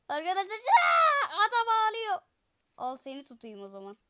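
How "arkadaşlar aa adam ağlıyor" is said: excited shriek. Real, not acted. Shrieking that man cries.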